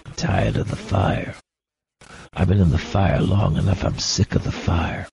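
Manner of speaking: weary, whispered whine; a tired, mock whimpering gripe. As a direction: whine like you're worn out by it. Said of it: "Maybe we kinda whimper".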